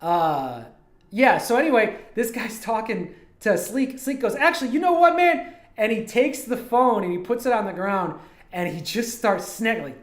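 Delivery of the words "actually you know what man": high, loud retold challenge; raised, heated mock comeback; acted confrontation. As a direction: imitate a streamer losing patience. Acts streamer snapping at passerby.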